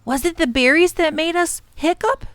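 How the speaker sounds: put-on high, questioning child voice; young Tom. A narrator's reading. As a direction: ask it like a puzzled boy. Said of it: "said Tom".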